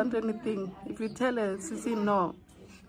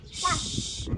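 shushing; 6 semitones higher than usual. Real, not acted. Hushing near sleeping dogs.

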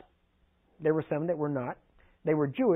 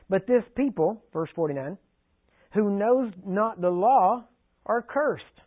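slow, louder, solemn Bible reading; preacher reading John aloud to the congregation, slow and louder; scripture reading; 3 semitones higher, 5 dB louder, slower than usual. Acted.

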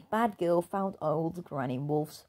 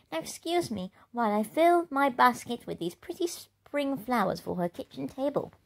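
higher, polite and prim; polite little wolf; 8 semitones higher than usual. Acted. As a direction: explain sweetly to the bully. Wolfie answers politely.

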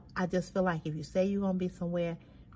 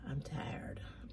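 breathy, exhausted near-whisper; weary whisper; 2 semitones lower, 11 dB quieter than usual. Real, not acted. Telling her viewers how worn out she is, in a weary whisper. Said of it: "when I tell you I'm tired".

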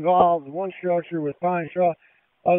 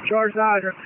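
raised, urgent call into the radio; loud order; 5 semitones higher, 4 dB louder than usual. Real, not acted. Commander to hydrant crew, loudly ordering the charge. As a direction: shout the order over the fire.

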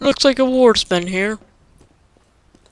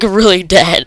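ordinary delivery

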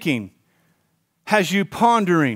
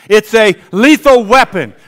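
the congregation echoes it louder; louder call-and-response; 3 semitones higher, 9 dB louder than usual. Shown as a demonstration. Pastor leads the church, echoing the line louder. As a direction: repeat it back with force.

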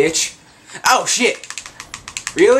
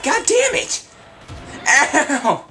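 much higher, yelled curse; frustrated shout; 11 semitones higher, much slower than usual. Real, not acted. Player getting shot, high frustrated yell. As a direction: yelp it in frustration.

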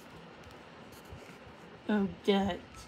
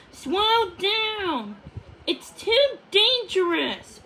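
high, pleading and louder; higher voice, begging for caution; Odette's frightened voice; 9 semitones higher, 5 dB louder, slower than usual. A narrator's reading. Odette warns Puffin it's too risky, high and pleading. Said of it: "Odette replied".

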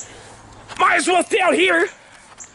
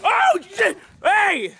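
outraged shouting; 3 semitones higher than usual. Real, not acted. Vlogger accuses Tyler of breaking TV, outraged shouting. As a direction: yell an accusation in real outrage. Quarrel over broken TV.

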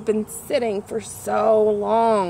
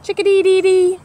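much higher and louder chirp; chickadee call; 9 semitones higher, 7 dB louder than usual. Acted. Reading to kids, a loud high chickadee call. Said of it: "Chickadee is an acrobat".

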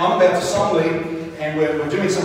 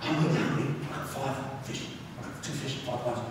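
secret whisper; 8 semitones lower, 16 dB quieter than usual. Retold or imitated. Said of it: "with a little secret".

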